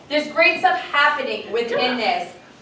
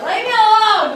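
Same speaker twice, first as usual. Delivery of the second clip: put-on drunk person; 9 semitones higher, 6 dB louder than usual. Shown as a demonstration. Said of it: "drunk people a lot of times are like".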